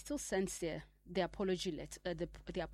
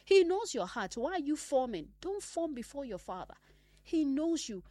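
higher, louder exhortation; preaching burst; 6 semitones higher, 5 dB louder than usual. Acted. Preacher pressing believers to stop pretending. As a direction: drive it home, urging them.

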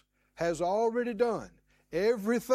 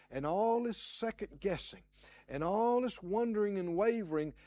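ordinary delivery

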